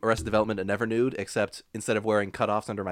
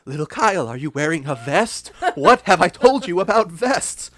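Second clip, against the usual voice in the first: loud, high, hammed-up telling-off; mock scolding mother; 7 semitones higher, 8 dB louder than usual. Imagined mother scolding little Kyle.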